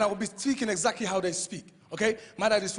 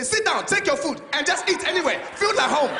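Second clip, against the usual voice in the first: louder, higher mock of dad barking welcome; imitating dad's scary welcome; 7 semitones higher, 4 dB louder than usual. Dad greeting son's friends, mock aggressive welcome. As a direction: make a friendly welcome sound like a threat. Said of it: "It scares them".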